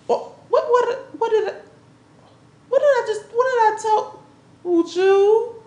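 high, sputtering what-what-what; indignant outburst. Acted. Woman cutting him off mid-argument, high and indignant. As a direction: stammer out the question in rising outrage.

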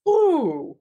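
high, moved ooh; real emotion catching in the throat; a sudden high ooh, touched by the daughter's words; getting emotional. Real, not acted. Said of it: "Oh, I'm getting emotional".

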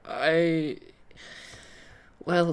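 ordinary talk, nothing marked

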